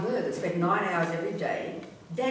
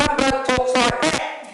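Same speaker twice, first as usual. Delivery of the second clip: loud, higher, drawn-out mock enthusiasm; super-enthusiastic presenter; 5 semitones higher, 10 dB louder, slower than usual. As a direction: mimic an over-excited wildlife presenter at full volume. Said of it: "They're both super enthusiastic".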